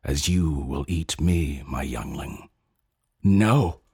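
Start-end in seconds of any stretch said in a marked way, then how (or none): 3.2-3.8 sharp cry of horror, a bit louder. A narrator's reading. Young one recoiling from its father's plan, crying out in horror. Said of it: "I cry in horror".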